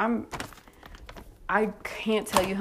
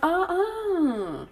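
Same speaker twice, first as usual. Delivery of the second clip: high, drawn-out uh-uh of refusal; retold warning; 11 semitones higher than usual. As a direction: sing out a mock warning. Retold warning after being touched.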